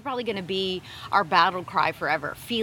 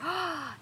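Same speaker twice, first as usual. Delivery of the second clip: gasp; 9 semitones higher, 5 dB quieter than usual. Real, not acted. Reacting to husband's point.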